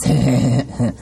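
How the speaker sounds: put-on dirty old man chuckle; lecherous laugh. A narrator's reading. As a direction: shameless cackle. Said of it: "laughs Mister Oog unashamedly".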